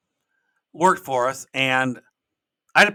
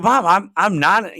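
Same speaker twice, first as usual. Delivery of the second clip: protesting son; 5 semitones higher, 7 dB louder, slower than usual. Retold or imitated. Son protests to scolding mom.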